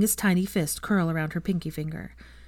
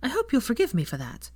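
higher, mildly sad request; sad plea; 6 semitones higher than usual. A narrator's reading. Hermione asking Teddy to forgive her absence, gently sad. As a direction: ask forgiveness with a touch of sadness. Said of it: "she said sadly".

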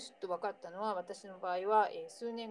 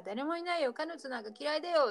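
put-on flustered kid; 8 semitones higher, 3 dB louder than usual. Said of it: "ミッキーが".

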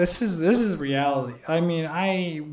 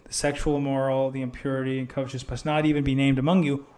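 ordinary delivery

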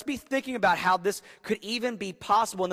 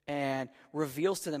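ordinary talk

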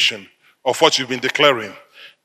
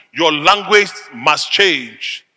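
normal talking voice

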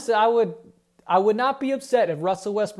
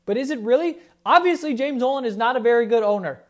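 exasperated outburst; 5 semitones higher, 6 dB louder than usual. Real, not acted. Heated rant about owner.

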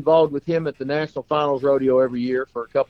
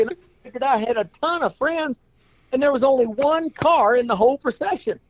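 much higher, hammed-up complaint; aggrieved clown; 11 semitones higher than usual. Clown complaining to his friend, hammed-up aggrieved. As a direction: wail about the empty procession.

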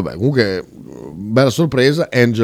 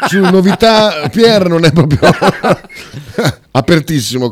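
laughing; 8 semitones higher, 5 dB louder than usual. Real, not acted. Joking about Pier's taste.

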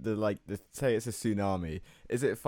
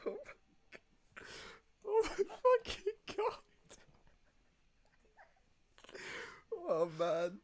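high squealing laugh-cry; laughing so hard it's almost crying; crying with laughter; 5 dB quieter than usual. Real, not acted. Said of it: "I'm crying laughing".

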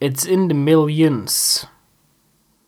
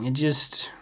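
whisper; 3 semitones lower, 9 dB quieter than usual. Real, not acted.

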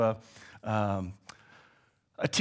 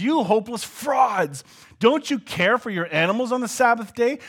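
loud, higher, forceful preaching rebuke; Jesus thundering at the hypocrites; 4 semitones higher, 5 dB louder than usual. Retold or imitated. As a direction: call them out at full voice. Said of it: "And here he says".

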